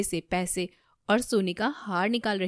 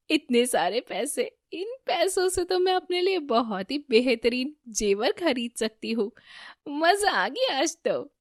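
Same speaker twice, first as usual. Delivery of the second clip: higher, delighted smiling thought; smiling glee; 5 semitones higher than usual. A narrator's reading. Damini to herself over the stolen money, smiling glee. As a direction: gloat happily with a smile in the voice. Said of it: "Damini बहुत मुस्कुराती है".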